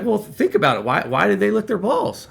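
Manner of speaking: high-pitched imitation of a prodding boss; acted insistent prompting; pressing question. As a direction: push the question, pitched well up. Acted boss pressing him for an answer.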